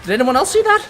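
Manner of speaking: high, loud disbelief; incredulous. Real, not acted. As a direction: call out to viewers, stunned. Stunned by a game glitch.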